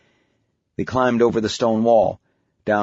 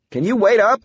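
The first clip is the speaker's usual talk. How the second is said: raised, higher voice calling ahead; 11 semitones higher, 4 dB louder than usual. Acted. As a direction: call after someone getting away. Dad calls after daughter running ahead.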